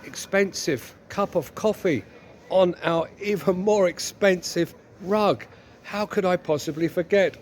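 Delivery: put-on wife voice, picking out each fault; mock-sweet wifely reproach; long-suffering wife, reproachful. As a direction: play a nagging wife, hammed up.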